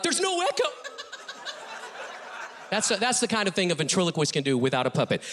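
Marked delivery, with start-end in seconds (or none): 0.0-0.7 puzzled high reply. Retold or imitated. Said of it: "And they're like".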